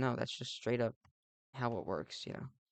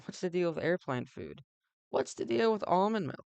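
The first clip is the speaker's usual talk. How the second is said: higher, louder hammed-up stand-up delivery; stand-up comic bit; 5 semitones higher, 4 dB louder than usual. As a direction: play the cheesy comedian asking the deal. Stand-up comedian cliché bit.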